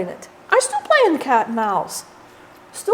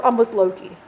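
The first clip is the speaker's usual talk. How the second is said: ordinary delivery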